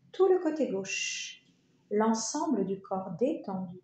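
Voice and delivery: ordinary talk, nothing marked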